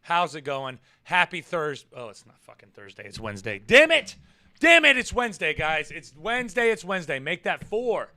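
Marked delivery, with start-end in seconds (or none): none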